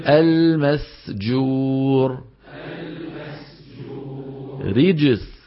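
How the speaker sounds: "المسجور، رجس" slow and held, pure s; clear seen. Shown as a demonstration. Teacher modelling recitation, "المسجور، رجس" with clean س. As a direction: keep the س unvoiced in "المسجور، رجس". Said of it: "خلط صوت السين بالزاي".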